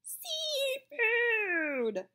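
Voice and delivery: put-on high squealing kid voice; a child squealing the word. A narrator's reading. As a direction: squeal it out shrill and loud. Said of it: "she would squeal".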